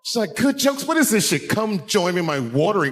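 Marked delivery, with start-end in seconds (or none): 0.4-1.5 high-pitched, indignant voice; wife's exasperated mock disbelief; imitated scornful wife. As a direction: read the joke back with scorn. Angry wife scoffs at the jokes.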